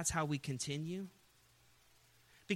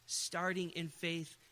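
normal talking voice

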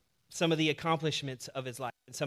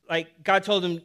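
ordinary delivery

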